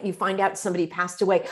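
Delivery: ordinary talk, nothing marked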